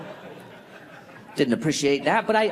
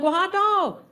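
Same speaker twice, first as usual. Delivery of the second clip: put-on Southern accent; 12 semitones higher than usual. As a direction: play the folksy Southern clerk. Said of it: "he was like".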